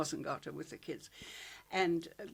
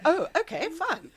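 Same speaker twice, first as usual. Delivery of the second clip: retold breezy acceptance; 11 semitones higher, 7 dB louder than usual. Retelling her childhood acceptance.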